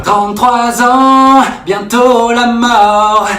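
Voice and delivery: very slow, high wailing lament; mock despair. Mock despair about aging. (lamentation lente et aiguë)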